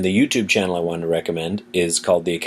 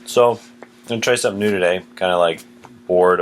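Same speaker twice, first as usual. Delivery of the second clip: ordinary talk, nothing marked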